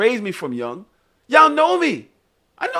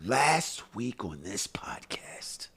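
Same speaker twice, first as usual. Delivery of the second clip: slow, hushed whisper; slow teasing whisper; 8 semitones lower, 7 dB quieter, much slower than usual. Acted. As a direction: lean in and whisper it like a secret. Teasing an earlier podcast story.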